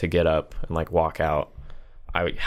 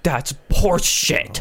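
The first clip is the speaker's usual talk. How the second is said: slow, higher, drawn-out mock outrage; mock angry yell; 8 semitones higher, much slower than usual. Old customer fumes at staff about the charge, mock angry. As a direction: stretch out the indignant complaint. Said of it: "he just starts fucking yelling".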